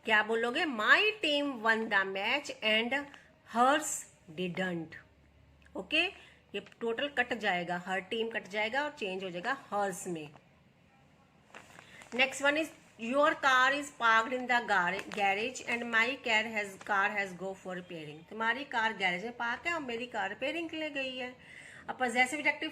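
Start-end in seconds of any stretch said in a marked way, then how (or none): 0.7-4.8 very slow, every word laid out for students; slow dictation read. Shown as a demonstration. Teacher reading the example to her students, slow and deliberate. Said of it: "teacher rereads answer sentence". 12.2-18.3 very slow, every word laid out for students; slow dictation read. Shown as a demonstration. Teacher reading the example to her students, slow and deliberate. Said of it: "teacher reads example sentence".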